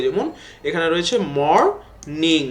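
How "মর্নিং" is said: "Morning" in two separate beats, mor, ning; split into syllables. Shown as a demonstration. Teacher dividing "morning" for pupils, split into two syllables. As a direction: say "morning" slowly with a gap before the second syllable. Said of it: "আমরা ভাগ করি".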